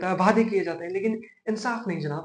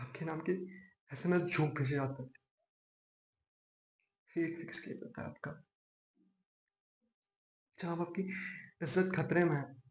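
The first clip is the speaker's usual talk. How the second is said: quiet plea; 2 semitones lower, 10 dB quieter than usual. Acted. Lawyer warning the judge his honour is at stake, quiet plea. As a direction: drop right down and implore softly.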